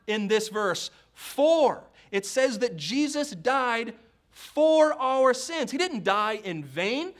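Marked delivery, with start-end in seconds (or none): 1.1-1.8 "for" punched high and loud; "for" standing alone, raised and forceful; emphatic key word. Real, not acted. Preacher singles out key word. 4.3-5.7 "for" loud and drawn out in slow preaching; weighty, slow phrase led by a big "for"; stress on "for". Real, not acted. Preacher stresses key word "for.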